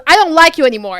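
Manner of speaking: loud, high imitation of mom snapping; exasperated yell. Retelling mom's outburst at the granddaughter, yelling. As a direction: throw it out loud and cross. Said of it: "then she goes like this".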